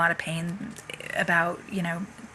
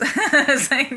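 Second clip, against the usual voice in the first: higher, louder laugh into the line; real laugh; 7 semitones higher, 5 dB louder, slower than usual. Real, not acted. Laughing at her own dazed reply to her husband. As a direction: break into laughter, pitch up.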